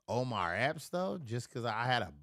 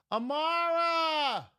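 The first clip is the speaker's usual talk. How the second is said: long, very high, loud call of a name; 6 dB louder, much slower than usual. Real, not acted. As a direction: call someone from across the house. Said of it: "calling out".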